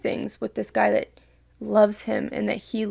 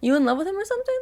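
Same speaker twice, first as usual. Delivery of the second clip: higher, taunting rhetorical question; mocking jab; 9 semitones higher, much faster than usual. Real, not acted. Vlogger taunting the bully, higher and sarcastic. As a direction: needle him with a sarcastic jab.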